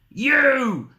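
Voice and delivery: high, loud pounce on the word; wolf's cry. Acted. Wolf revealing himself to Granny, loud high cry. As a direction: cry it out in wolfish triumph. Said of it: "cried the wolf".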